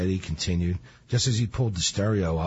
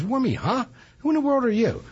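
high, disbelieving burglar; incredulous burglar. Retold or imitated. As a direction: scoff up high, who on earth are you. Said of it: "He goes".

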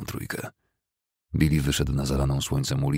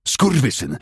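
loud, higher furious curse; enraged yell; 9 semitones higher, 5 dB louder, slower than usual. Acted. Fessenden cursing at Billy, loud yell. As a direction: spit the insult out. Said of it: "wrzasnął Fessenden".

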